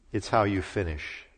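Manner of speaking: faint, frail whisper of a reply; a hint of a dying father, softly; weak voice. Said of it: "in a very weak voice".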